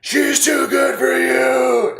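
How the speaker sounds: slow, high, loud yell; yelled taunt. Retold or imitated. Yelling at a passing couple from the car, slow high taunt. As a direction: holler it out a car window. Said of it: "one of my favorite things to yell".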